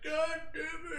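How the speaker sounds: high, wailing mock sob on the curse; imitating crying trucker. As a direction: whine it out like a broken-down trucker. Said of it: "he's sitting there crying".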